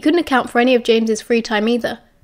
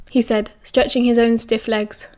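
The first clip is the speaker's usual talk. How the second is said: usual speaking voice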